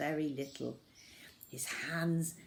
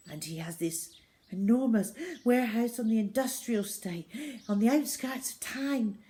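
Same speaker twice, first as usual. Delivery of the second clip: panting; 5 semitones higher, 4 dB louder than usual. Acted. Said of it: "panted Zoe".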